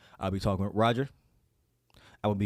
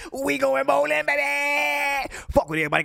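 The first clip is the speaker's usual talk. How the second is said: high, loud screaming hype; shouting hype; 6 dB louder than usual. Real, not acted. Fan screams to viewers about the Super Bowl, high and loud. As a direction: scream it like the team just won.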